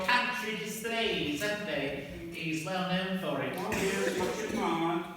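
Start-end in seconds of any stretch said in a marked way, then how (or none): none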